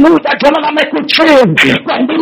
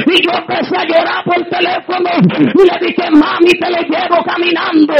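higher, rising preacher's cry, shouting praise; fiery preaching; 4 semitones higher, 4 dB quieter than usual. Real, not acted. Preacher testifying to the congregation, full-force preaching. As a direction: preach it out with building fervor.

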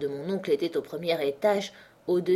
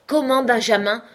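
louder, higher cry of alarm; shocked exclamation; 5 semitones higher, 7 dB louder than usual. A narrator's reading. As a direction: gasp it out, gone pale. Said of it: "s'écria Mâchecourt". (Mâchecourt s'écrie, blême de peur)

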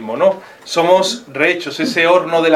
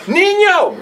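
sudden exclamation; 3 dB louder than usual. Acted. Exclaims at himself after confession.

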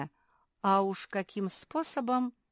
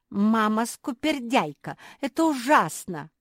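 put-on upset child; 6 semitones higher, 8 dB louder than usual. A narrator's reading. Naretta upset.

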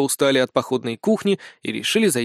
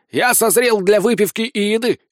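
high, rousing announcement; exclaiming; 7 semitones higher, 4 dB louder than usual. A narrator's reading. Sergeant suddenly ready for drink and food, exclaiming. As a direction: proclaim a sudden appetite with gusto. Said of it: "воскликнул Сисюля".

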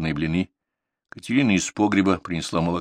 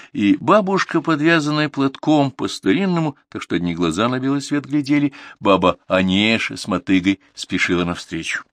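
ordinary delivery, nothing marked

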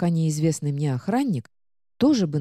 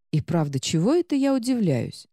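ordinary delivery, nothing marked

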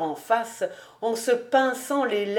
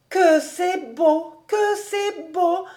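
higher, slow, wondering sigh; admiring sigh; 8 semitones higher, slower than usual. A narrator's reading. Suzel sighing over the song, higher and slow. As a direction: breathe it in awe. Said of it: "soupirant tout bas".

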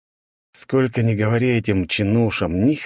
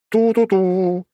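higher "ту-ту-ту" hooted like a train whistle; train horn imitation; 12 semitones higher, 3 dB louder, much slower than usual. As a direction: hoot it like a steam engine horn. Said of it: "заревел рядом гудок".

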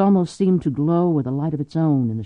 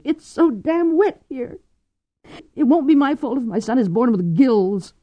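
higher, rising anxious outburst; Cordelia distraught; 6 semitones higher than usual. A narrator's reading. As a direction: tumble into tearful illogic. Cordelia's burst of illogic.

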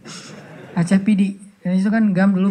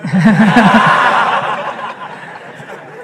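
real laugh in a run of bursts; hearty laughter. Real, not acted. Speaker laughing with his audience at the joke, amused. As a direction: laugh along with the crowd.

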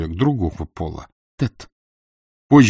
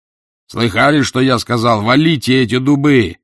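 commanding shout; 3 semitones higher, 5 dB louder than usual. Acted. Father orders workers to fell oaks.

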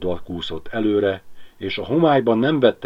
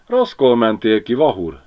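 gloating; 4 semitones higher, 6 dB louder than usual. A narrator's reading. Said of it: "kajánkodott Miska".

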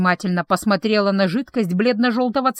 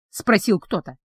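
plain reading voice

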